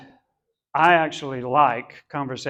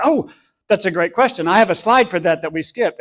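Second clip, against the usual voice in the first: louder, higher, enthusiastic re-enactment; eager reply; 4 semitones higher, 6 dB louder than usual. Retold or imitated. Speaker answering another parent's question about slides, eagerly. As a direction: mimic yourself pouncing on a great question.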